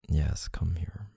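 soft, breathy near-whisper; tender murmur. Acted. Partner invites loved one in for comfort, tender murmur. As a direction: murmur it softly to draw them close.

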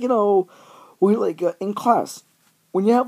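ordinary talk, nothing marked

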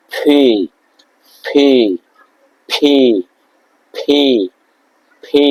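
ফি with a strong puff of air; P as ফি, breathy burst; aspirated P. Shown as a demonstration. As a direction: blow air through the lips on ফি. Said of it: "দুই ঠোঁটের মাঝখান দিয়ে একটা বাতাস বের হবে".

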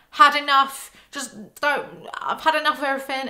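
hammed-up fed-up mum, high and loud; mock dramatic mum post. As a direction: parody a mum's vague Facebook post, fast. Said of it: "write things on Facebook like".

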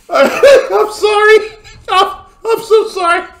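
high wheezing laugh through the words. Real, not acted. Viewer laughing too hard at the clip to speak. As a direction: apologise while still cracking up.

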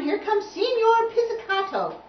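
high, loud heralding call. A narrator's reading. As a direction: announce the arrival at full voice. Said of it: "called the French horn".

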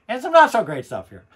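laughing through the words. Real, not acted. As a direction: laugh as you say it. Jokes about bad records.

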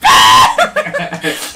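high shrieking laugh; loud burst of laughter. Real, not acted. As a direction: crack up loudly. Laughing at own stumble.

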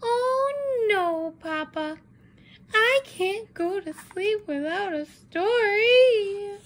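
high, slow whining chick; high drawn-out chicken plea; whiny chicken. Acted. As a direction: wail like a child refusing bed. Chicken whines at bedtime.

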